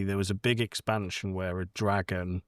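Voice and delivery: ordinary delivery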